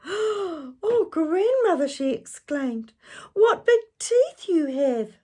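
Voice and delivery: very high, loud alarmed cry; shocked girl. Acted. Girl seeing the wolf's teeth, alarmed. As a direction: cry it out in fright.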